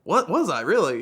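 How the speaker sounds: high, loud mock disbelief; feigned surprise. Driver told he was speeding, playing surprised.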